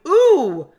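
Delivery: mock-delighted tasting verdict; Ella sniffing. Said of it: "Ella going".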